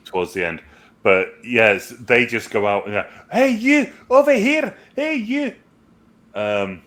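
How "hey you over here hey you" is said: high shouted Scottish hobbit call; Scottish accent. Retold or imitated. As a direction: yell it like a cheeky Scottish hobbit. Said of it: "Billy Boyd is Scottish".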